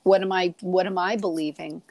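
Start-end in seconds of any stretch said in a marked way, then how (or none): none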